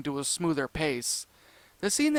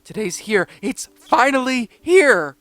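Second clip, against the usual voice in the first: high, loud, slow joyful yell; excited shout; 7 semitones higher, 10 dB louder, slower than usual. A narrator's reading. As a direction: shout it out in pure delight. Austin bursting with excitement.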